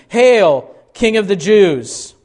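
high, ringing mock hail; soldiers' salute. Soldiers mockingly saluting Jesus as king, loud salute. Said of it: "they began to salute him".

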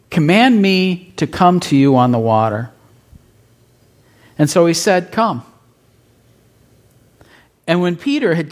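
measured, solemn Bible reading; scripture reading. Acted. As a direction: read the passage with reverence. Reads scripture passage aloud.

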